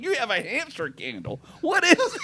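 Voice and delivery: high, amused disbelief. Retold or imitated. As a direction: ask it half laughing, amazed. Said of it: "I was like".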